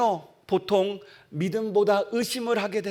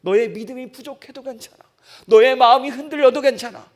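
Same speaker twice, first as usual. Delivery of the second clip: God's reassurance; 4 semitones higher, 9 dB louder than usual. Acted. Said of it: "얘기하시는 거예요".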